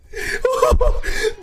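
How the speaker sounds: high-pitched burst of laughter; delighted laugh. Real, not acted. Delighted by the animation.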